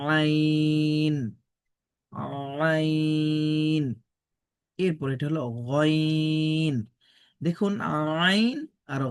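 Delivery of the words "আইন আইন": আইন squeezed from mid-throat, drawn out slow; throaty ain. Shown as a demonstration. Teacher showing the throat sound of আইন, slow. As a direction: press আইন from the throat and hold it. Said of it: "গলার মাঝখান থেকে একটু চেপে".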